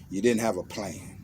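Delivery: usual speaking voice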